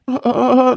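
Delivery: choked sobs, broken breaths; choked sobs of guilt; distressed sobbing. Acted. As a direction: sob out in shock. Husband sees injured wife's eye.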